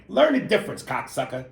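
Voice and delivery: ordinary talk, nothing marked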